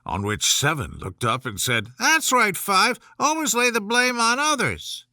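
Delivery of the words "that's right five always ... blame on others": higher, sarcastic jab; mocking retort. Seven mocking Five for passing blame, sarcastic. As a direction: needle him with mock agreement.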